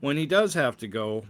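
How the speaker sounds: ordinary delivery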